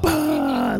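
sudden high whoop out of cold water; high gasping whoop. Retold or imitated. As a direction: gasp it out, shocked and thrilled. Gasping up from ice plunge.

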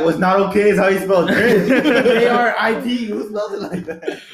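loud, laughing ridicule; mock comeback. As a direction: scoff through laughter at the misspelling. Friend acts out a mocking comeback.

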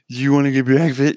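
hammed-up dim girl voice; mock dopey voice. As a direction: play her as a dopey caricature. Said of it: "She didn't talk like that".

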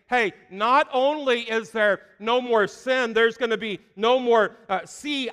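voicing God. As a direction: proclaim as God casting sins away. Preacher voicing God's words.

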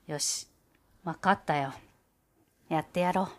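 a gruff man agreeing grudgingly; low gruff cellist. Acted. Gauche agrees to help the mice, low and gruff.

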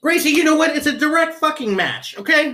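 high, loud sarcastic exasperation; exasperated outburst. Real, not acted. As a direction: snap back in mock frustration.

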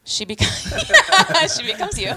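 breaks into high laughter mid-line; laughing. Real, not acted. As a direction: laugh through the words. Laughing at partner's joke.

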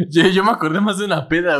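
usual speaking voice

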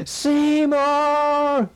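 high, long drawn-out call of Seymour; Chalmers calling. Retold or imitated. Chalmers calling Skinner's name, very high and drawn out. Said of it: "And then it goes".

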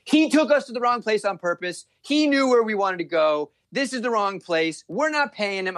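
high, indignant complaint; wife fuming that the driver cheated them; mock angry wife. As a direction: imitate the angry wife accusing. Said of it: "she's like".

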